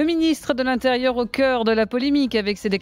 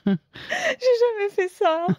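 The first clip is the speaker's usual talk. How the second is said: high, slow, laughing near tears; fighting a fit of giggles; hysterical laugh; 10 semitones higher, much slower than usual. Real, not acted. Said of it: "Remise de son fou rire".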